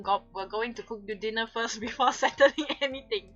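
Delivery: ordinary talk, nothing marked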